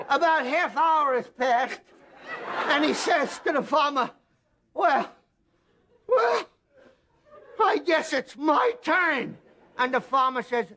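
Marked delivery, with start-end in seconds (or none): 0.1-4.1 strained high narration. A narrator's reading. 4.7-9.4 much higher, squeezed and slow; a high pained squeak, still hurting; strained squeaky voice. Acted. Guy recovering, squeezed voice.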